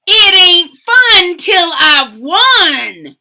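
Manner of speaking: loud, slow, bossy declaration; a spoiled pug's loud insistence; bratty pug. Acted. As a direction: stamp your foot on every word. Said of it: "But Pig would reply".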